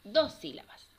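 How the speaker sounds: ordinary talk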